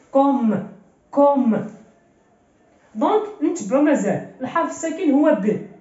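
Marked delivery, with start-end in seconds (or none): 0.1-1.7 "كوم" louder, closed on a vowelless M; stop on silent-vowel M. Shown as a demonstration. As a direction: land firmly on the final M of "كوم". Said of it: "غنوقف عليه بالسكون". (نطق "كوم" بالوقوف على الميم بالسكون)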